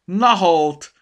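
high, loud market woman's cry; full-voiced startled exclamation; shouting. A narrator's reading. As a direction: shout it out in astonishment. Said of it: "kiáltotta a kofa".

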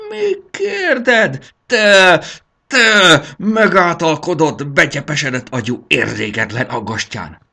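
high, loud, furious scolding; blaring tirade. A narrator's reading. Dragon wife scolds her daydreaming husband, blaring tirade. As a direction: thunder out the grievances at full force.

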